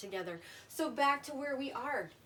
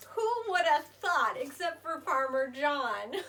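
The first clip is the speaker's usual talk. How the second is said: excited; 3 semitones higher, 5 dB louder than usual. Real, not acted. Excited after reveal.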